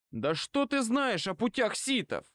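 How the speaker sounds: high-pitched indignant outcry, little added volume; crying out. A narrator's reading. Bane retorts to her about the Sith ways, crying out. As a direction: throw the challenge out high and heated. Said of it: "выкрикнул он".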